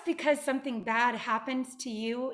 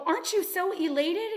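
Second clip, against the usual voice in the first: excited workers; 8 semitones higher than usual. Acted. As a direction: ask it like a giddy farmhand. Workers cheer farmer.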